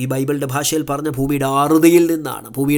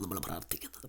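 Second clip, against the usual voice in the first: low reverent whisper; whispered call to prayer; 8 semitones lower, 20 dB quieter, faster than usual. Acted. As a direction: hush it, lower and whispered. Invitation to prayer, hushed.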